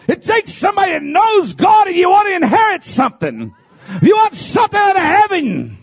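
high, loud, impassioned preacher's cry; full-force preaching. Acted. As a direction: preach it out to the congregation with fire.

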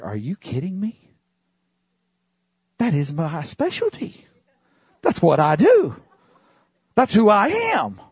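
high, loud incredulous delight; excited disbelief. Retold or imitated. Preacher retelling his reply to a businessman, excited and high. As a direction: throw it up high in mock amazement. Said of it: "I'm like".

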